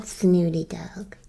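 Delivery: whisper. Real, not acted. Teasing the dog under her breath.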